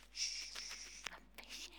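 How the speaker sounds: whispered shh, I'm fishing; hushed angler. Retold or imitated. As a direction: hush them like an angler shooing noise. Angler hushing people.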